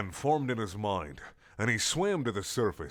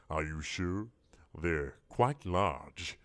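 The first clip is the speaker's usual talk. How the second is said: low, soft, very slow tease; sly monster tease; teasing squid; 4 semitones lower, 4 dB quieter, slower than usual. Acted. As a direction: draw it out low.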